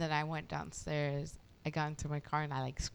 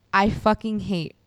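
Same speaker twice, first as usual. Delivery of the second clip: emphatic rant; 2 semitones lower, 10 dB louder than usual. Real, not acted. Friend rants to friends about blue cheese, loud and emphatic. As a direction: declare your hatred loudly, word by word.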